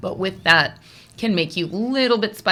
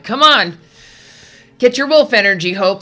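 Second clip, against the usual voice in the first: pep talk; 2 semitones higher, 10 dB louder than usual. Retold or imitated. Reader urging on the little dogs, rallying. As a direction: rouse them to toughen up. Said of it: "Sort of like, "Buck up".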